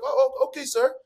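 playful mock courtesy to a doubter; mock polite. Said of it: "I'll be like".

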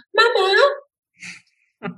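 put-on high, drawn-out call of a child; child calling for mum. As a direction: imitate a kid calling out for Mama. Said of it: "ist der erste Impuls immer".

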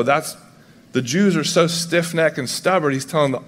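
normal talking voice

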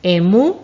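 "Emú" dicho fuerte, subiendo en la U final; acento en la U. Shown as a demonstration. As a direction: modela "Emú" cargando la última sílaba. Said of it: "con acento en la U". (la U de "Emú" acentuada y sostenida)

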